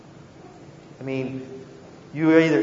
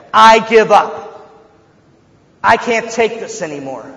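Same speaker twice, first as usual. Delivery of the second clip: mock give-up; 4 semitones higher, 6 dB louder than usual. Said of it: "get into the thick of things and say".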